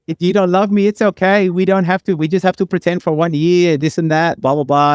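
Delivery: high-pitched imitated Brazilian accent. Woman talks him into a sham marriage, mock Brazilian accent. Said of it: "can you do her Brazilian accent".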